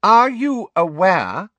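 high, slow, loud interruption; Umbridge, loud. A narrator's reading. Inspector interrupting the teacher's lesson, loud. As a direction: cut in officiously, stretching each word. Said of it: "Umbridge said loudly, interrupting him".

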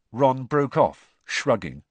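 ordinary delivery

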